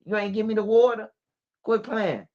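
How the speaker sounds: a hint of a casual mother shrugging it off; mama's voice. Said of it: "You just walked in there and said".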